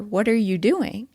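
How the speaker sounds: normal talking voice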